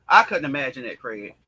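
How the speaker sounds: ordinary talk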